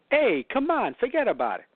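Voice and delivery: high-starting, hammed-up New York accent. Coach joking about school bus talk, mock New York accent. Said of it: "have someone do that accent".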